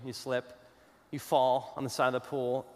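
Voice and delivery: usual speaking voice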